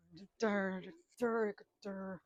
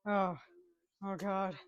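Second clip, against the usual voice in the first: ordinary delivery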